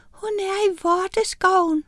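high, small witch voice; tiny witch voice. Acted. Little witch answering Miranda, high and tiny.

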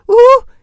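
high, loud "ooh" of disgust; startled high-pitched shudder sound; shuddering squeal. A narrator's reading. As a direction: squeal as if something creepy landed on you. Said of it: "gøs hun".

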